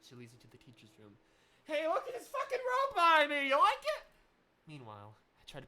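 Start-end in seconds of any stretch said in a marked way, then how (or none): 1.6-4.0 loud, high, laughing outburst; loud gleeful shout through laughter; excited shout. Real, not acted. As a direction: yell it out with a laugh, thrilled. Streamer bursts out mid-read.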